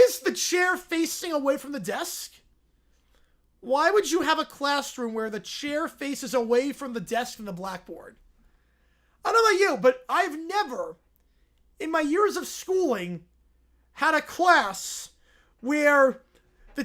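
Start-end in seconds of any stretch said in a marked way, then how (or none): none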